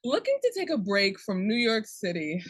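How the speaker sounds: normal talking voice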